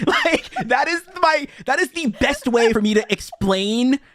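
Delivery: high-pitched, laughing through the words; cracking up. Real, not acted. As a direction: squeal it out as the laughter takes over. Cracking up at own analogy.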